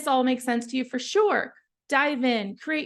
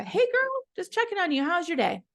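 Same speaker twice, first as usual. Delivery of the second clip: bubbly friendly check-in; 6 semitones higher than usual. Retold or imitated. Sample friendly text to a contact.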